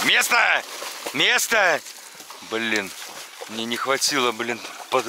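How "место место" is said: loud raised order to the dog; stern dog command. Real, not acted.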